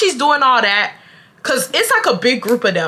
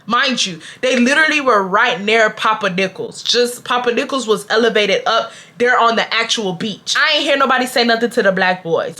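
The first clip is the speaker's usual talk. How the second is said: usual speaking voice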